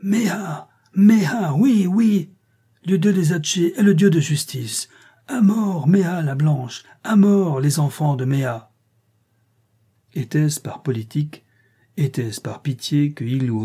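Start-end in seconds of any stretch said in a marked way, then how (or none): 0.0-8.7 much higher, hate-filled mob cries; furious mob demanding Meha's death; enraged crowd. A narrator's reading. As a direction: scream for her death in rage. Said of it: "une exclamation de rage et de haine".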